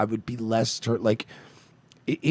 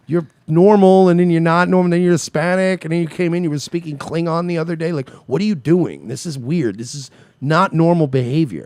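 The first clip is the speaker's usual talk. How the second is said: higher, louder, exasperated rant; 7 semitones higher, 6 dB louder than usual. Retold or imitated. Host imagines ranting at a girlfriend who keeps switching accents. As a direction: rant at her in disbelief. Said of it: "I'd be like".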